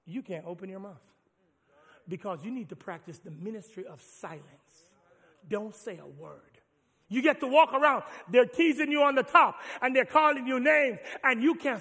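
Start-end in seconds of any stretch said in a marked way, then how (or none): 0.1-6.6 softer, lower, deliberate hush; God telling them to keep silent; quiet command. Acted. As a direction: lower the voice like a stern secret. God's quiet command, acted.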